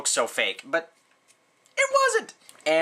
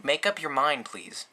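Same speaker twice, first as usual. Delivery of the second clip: ordinary delivery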